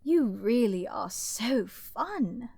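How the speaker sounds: slow teasing. Acted. Villain teases slowly.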